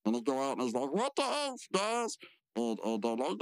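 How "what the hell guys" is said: high-pitched indignant cry; mock outrage. Mordecai confronting the pranksters, high mock outrage. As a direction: protest in a much higher voice.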